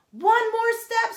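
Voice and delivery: high, loud cheering scream. Acted. Bobby screaming encouragement to Kat at the wall, high and loud. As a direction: scream her up the last bit of wall. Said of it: "screamed Bobby".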